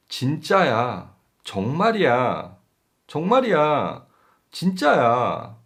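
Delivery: insisting it's true. Shown as a demonstration. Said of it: "so we can say".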